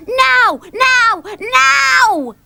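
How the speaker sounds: very high, loud, impatient cries; excited shouting. A narrator's reading. Dilly demanding to leave for the beach, excited shouts. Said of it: "jumped up and down with excitement".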